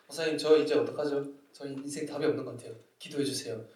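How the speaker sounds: low, soft, dejected voice; imitated downcast plea. Student asking the pastor for prayer, quietly dejected. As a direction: plead quietly, as if out of answers.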